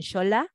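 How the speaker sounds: xola con la X como sh suave. Shown as a demonstration. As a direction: pronuncia xola empezando con un sonido sh. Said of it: "en otros suena más suave". ("xola", con X suave, como en show)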